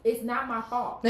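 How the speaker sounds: loud, slow, indignant; loud protest. Real, not acted. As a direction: protest loudly, dragging it out. Defending herself while laughing.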